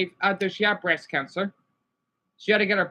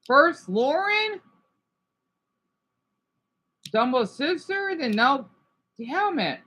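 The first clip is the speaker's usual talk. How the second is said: high, strained voice on the edge of crying; real distress over a friend's cancer news; upset, near tears; 9 semitones higher, 3 dB louder than usual. Real, not acted. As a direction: let it crack as the grief rises. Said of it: "I'm gonna get emotional from here".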